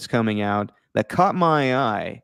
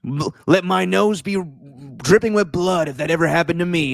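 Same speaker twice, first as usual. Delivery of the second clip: higher put-on villain voice; hammed-up creature villain, pitched up; Danny DeVito's Penguin; 4 semitones higher than usual. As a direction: imitate a creepy penguin-like movie villain. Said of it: "the Danny DeVito version of the Penguin".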